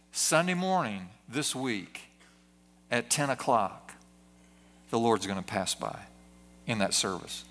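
low, hushed, portentous proclamation; solemn announcement. Acted. Preacher imagining an angel's announcement to the community, solemn and low. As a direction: announce it low and softly, with weighty pauses.

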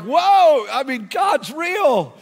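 loud, high, drawn-out astonishment; amazed outburst. Retold or imitated. Riders reacting to the driver's story, astonished shout. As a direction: burst out in slow, high-pitched awe.

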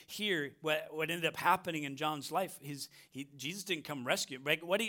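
ordinary talk, nothing marked

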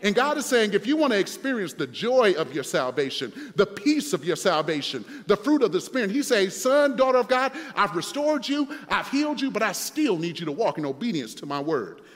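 loud, driving sermon delivery; forceful preaching. Acted. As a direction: preach it with rising emphasis on key words.

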